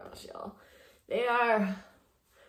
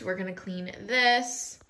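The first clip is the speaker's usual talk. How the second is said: ordinary delivery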